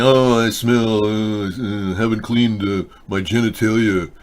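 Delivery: very slow, hesitant mock whine; mocking excuse. Host mocks a viewer's excuses about smelling, slow mock voice. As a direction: drag out a lame excuse. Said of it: "You're saying".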